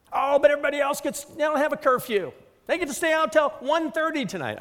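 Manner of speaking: put-on high, fast pleading teen protest; whiny teenager. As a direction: whine like a kid begging to stay out. Mimicking a teen's protest.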